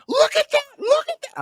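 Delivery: very high, loud mock yell; imitated yelling. Brother-in-law yelling about New York drivers, imitated high yell. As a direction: yelp it like a rattled driver. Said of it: "my brother-in-law's yelling".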